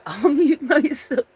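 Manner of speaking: high, loud laughing line; can't stop giggling. Real, not acted. Streamer laughing at herself, promising to mute, laughing.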